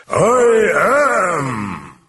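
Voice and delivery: huge high burst dropping away; booming demon voice. Acted. Demon answers the priest, booming. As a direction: boom it out like a demon. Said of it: "terrifying voice boomed".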